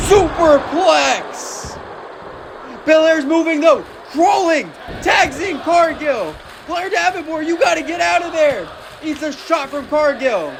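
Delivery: high, shouting hype call; wrestling commentator. Acted. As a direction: scream the big spot to the crowd.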